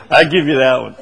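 laughing. Real, not acted. Conceding a joke to colleague.

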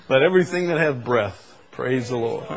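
usual speaking voice

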